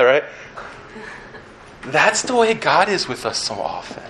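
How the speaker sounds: normal talking voice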